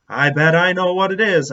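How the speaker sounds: loud, eager burst of realization; excited guess. Acted. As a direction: blurt out the idea.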